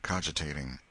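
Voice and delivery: usual speaking voice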